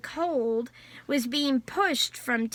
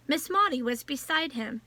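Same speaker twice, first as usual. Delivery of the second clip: ordinary delivery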